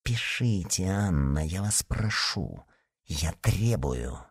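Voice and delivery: soft, slow, low urging; hushed, measured plea turning to demand; quiet insistence. A narrator's reading. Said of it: "Затем прибавил тихо".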